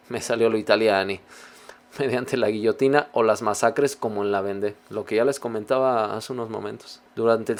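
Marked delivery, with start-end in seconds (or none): none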